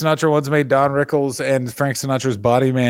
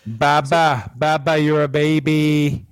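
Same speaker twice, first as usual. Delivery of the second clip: mock baby taunt; slower than usual.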